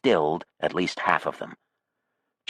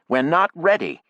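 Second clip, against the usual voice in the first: sharp; 11 semitones higher, 4 dB louder than usual. A narrator's reading. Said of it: "she said sharply".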